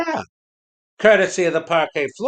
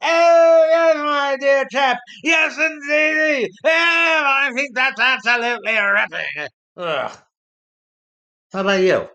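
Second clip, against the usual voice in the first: mock upper-class British exclamation; 4 semitones higher than usual. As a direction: ham up the posh English gentleman. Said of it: "making you do the ... the British thing".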